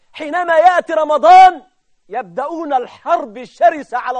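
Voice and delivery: loud, high, ringing denunciation; driving pulpit oratory with a hammered list; full-force preaching. Real, not acted. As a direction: thunder it out phrase by phrase.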